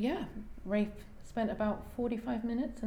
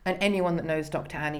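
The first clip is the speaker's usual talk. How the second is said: ordinary talk, nothing marked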